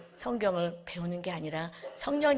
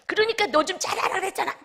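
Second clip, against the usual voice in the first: higher, louder scolding in a put-on voice; nagging rebuke; 11 semitones higher, 5 dB louder than usual. Preacher mimics a complaining believer blaming family, mock scolding.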